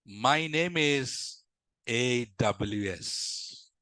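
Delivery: very slow, drawn-out introduction; dramatic name reveal. Retold or imitated. Mysterious helper reveals his name.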